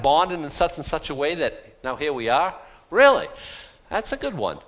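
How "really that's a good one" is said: mock disbelief, pushed up high; incredulous. Real, not acted. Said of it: "scoffs at big bang story".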